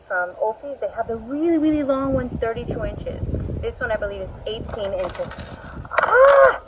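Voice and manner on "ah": sudden high, loud yelp; startled shriek. Real, not acted. Gardener caught by a gust, startled shriek. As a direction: cry out as the wind catches you off guard. Wind startles her while filming.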